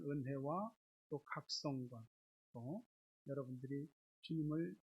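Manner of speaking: soft, low blessing over the congregation; hushed, low pastoral welcome. Real, not acted. Pastoral blessing opening the service.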